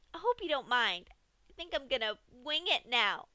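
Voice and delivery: sing-song joke before the cut; gleeful Pinkie. A narrator's reading. Pinkie joking to Dash before cutting, high and bubbly.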